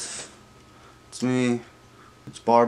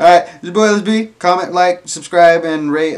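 louder, higher sign-off; hyped vlog outro; 5 semitones higher, 4 dB louder than usual. Acted. Host signing off to viewers, upbeat and louder. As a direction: wrap up like a YouTube host asking for likes.

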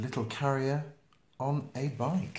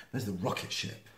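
ordinary delivery

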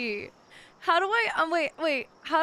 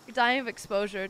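usual speaking voice

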